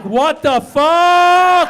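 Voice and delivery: loud, high, hugely drawn-out disbelief; imitating an appalled daughter, stretched shout; shocked outburst. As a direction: bellow it slowly in mock shock. Daughter's shocked reaction, acted.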